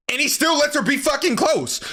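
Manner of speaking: high-pitched incredulous complaint; exasperated. Real, not acted. Exasperated at the character.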